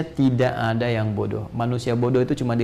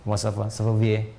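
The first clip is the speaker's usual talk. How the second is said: low, slow mock French accent; lightly put-on French accent; imitating French speaker; 4 semitones lower, slower than usual. Shown as a demonstration. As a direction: greet casually like a French speaker, unhurried. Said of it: "Kayak orang Prancis".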